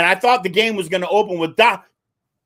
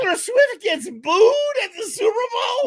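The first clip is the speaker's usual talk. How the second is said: mock outrage; 12 semitones higher than usual. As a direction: shout it in fake shock.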